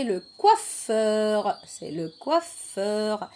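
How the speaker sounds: COIFFEUR slowly, with a lingering F; drawn-out sounds. Shown as a demonstration. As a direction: stretch out "coiffeur" so each sound is heard. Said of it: "Est-ce que j'entends feu dans coiffeur".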